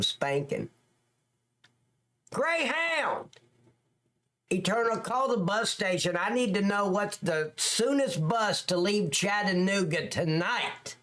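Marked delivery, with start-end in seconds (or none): 2.3-6.6 much higher, eager cry of Greyhound; high, excited Greyhound!; excited exclaim. Real, not acted. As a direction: call out Greyhound like a sudden plan. Plans to ride bus to viewer.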